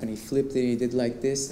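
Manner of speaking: ordinary talk, nothing marked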